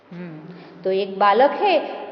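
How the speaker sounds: normal talking voice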